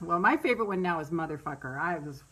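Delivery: ordinary talk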